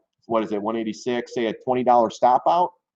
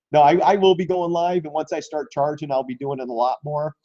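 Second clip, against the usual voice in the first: ordinary delivery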